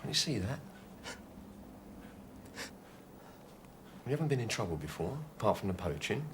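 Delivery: gentle coaxing. Acted. Coaxing gunman to surrender.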